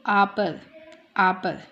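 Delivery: आपर with a long, drawn-out first आ; long vowel. Shown as a demonstration. Teacher giving examples of long sound, आपर with stretched आ. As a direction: lengthen the opening आ of आपर. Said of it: "long sound जाएगी".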